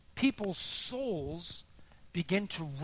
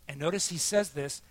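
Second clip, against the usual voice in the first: ordinary talk, nothing marked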